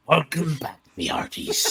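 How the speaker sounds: pirate greeting, hammed up; pirate accent. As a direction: ham up a pirate brogue. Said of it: "I'm going all over the place with that accent".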